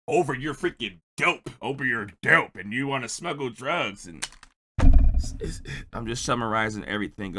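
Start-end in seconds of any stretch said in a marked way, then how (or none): none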